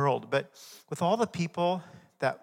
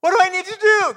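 desperate cry for help; 15 dB louder, faster than usual. Acted. Climber dangling from a cliff cries to God, very high panicked shout. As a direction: scream it up to heaven, hanging on for life.

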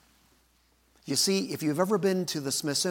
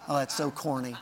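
ordinary talk

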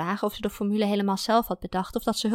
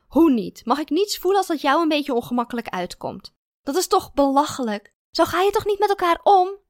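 high, loud indignant tirade; hurt girlfriend shouting; angry quarrel; 8 semitones higher, 6 dB louder than usual. A narrator's reading. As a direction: build up in outrage. Esther's escalating quarrel.

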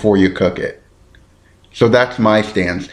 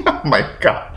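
helpless laugh through the words, higher; 6 semitones higher than usual. Real, not acted. Podcaster tripping over a sauce name, laughing. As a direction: crack up at yourself. Cracks up at own pronunciation.

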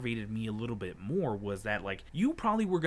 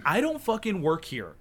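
loud, high retold outburst; re-enacted furious comeback; 6 semitones higher, 7 dB louder than usual. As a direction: yell it in exasperation. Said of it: "So I was furious".

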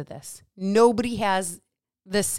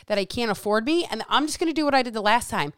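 ordinary talk, nothing marked

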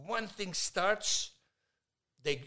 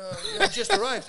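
mock innocent; 6 dB louder than usual. Cousin caught, acting innocent.

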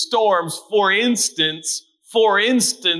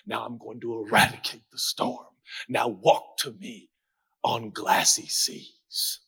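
hushed, unhurried divine command; calm voice of God; 9 semitones lower, 7 dB quieter than usual. Acted. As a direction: speak gently, as if stilling the sea. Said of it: "God said".

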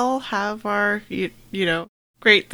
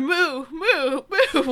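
mooing along; 6 semitones higher, slower than usual. Acted. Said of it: "And Ren's going".

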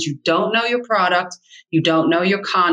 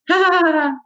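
nervous giggle imitation; 10 semitones higher than usual. Coach mimicking a nervous agent's giggle, high nervous laugh. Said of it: "She's nervous, so she laughs".

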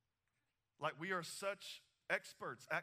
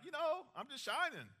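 high, airy put-on voice; mock answer pitched way up, dreamy and evasive; airy mock reply; 11 semitones higher, 5 dB louder than usual. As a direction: play the vague believer, floating the words up high. Mocking a vague answer.

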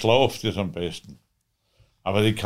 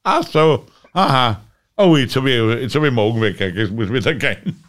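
mock dismayed exclamation; flustered surprise; 2 semitones higher, 6 dB louder than usual. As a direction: act caught off guard and backing out. His flustered reply retold.

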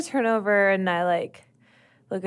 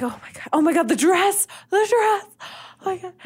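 high, squealing excitement over the dress; excitedly fussing about the dress, rising high; giddy excitement; 9 semitones higher than usual. Acted.

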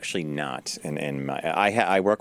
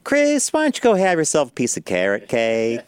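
lightly voiced sweet coworker; a sweet, pointed suggestion; impression of Karen; 6 semitones higher, 4 dB louder than usual. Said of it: "Karen would go".

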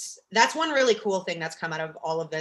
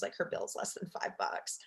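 hushed, softer whisper to the regulars; whispered aside; 12 semitones lower, 11 dB quieter than usual. Retold or imitated. Server whispering to the regulars about the small bill. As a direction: lean in and whisper it like a server sharing a secret.

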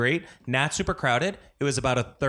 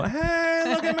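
high showy. Retold or imitated. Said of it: "I go".

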